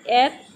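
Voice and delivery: एप said alone as the first syllable of एप्पल; just the syllable एप, cut off from पल; isolated syllable. Shown as a demonstration. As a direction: set एप apart as one beat. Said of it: "इसमें कितने part हो रहे हैं".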